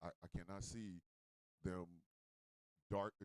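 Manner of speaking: low, quiet deadpan; mock dismay. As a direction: refuse under your breath, voice sunk low. Acts out deadpan refusal to see.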